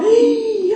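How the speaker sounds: sudden sharp gasp of craving; mock gasp. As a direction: gasp like you just spotted food.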